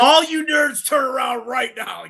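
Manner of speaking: high, loud, slow mock bellow; mock shout. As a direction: bark it at the nerds. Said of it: "I'm like".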